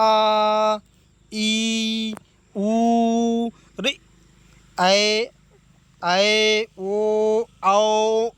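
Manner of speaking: each vowel, आ, ई, ऊ, आए, ओ, आओ, held long, loud and level; drawn-out vowels. Shown as a demonstration. Teacher showing students pluta vowels, drawn out long. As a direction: stretch आ, ई, ऊ and the rest well past normal length. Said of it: "ऐसे बोलते हैं ना, काफ़ी देर समय लगाते हैं".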